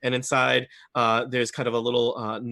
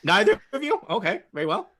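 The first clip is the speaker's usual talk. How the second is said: higher, laughing little gnome; amused gnome shopkeeper; 7 semitones higher, 4 dB louder than usual. Acted. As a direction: chuckle in surprise as the gnome. GM voices gnome merchant.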